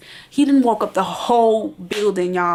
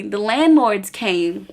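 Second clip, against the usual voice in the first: ordinary talk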